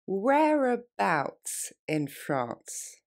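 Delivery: very slow, spelled-out delivery; slowly. Shown as a demonstration. Teacher repeating slowly for French students. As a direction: stretch the phrase out for learners. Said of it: "On reprend".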